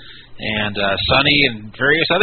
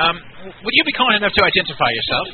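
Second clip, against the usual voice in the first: put-on a lightly done English accent as an old army colonel; British colonel accent; 3 semitones higher than usual. Prank caller ringing the casino as an English colonel, light British accent. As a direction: play the stiff English colonel, barely put on.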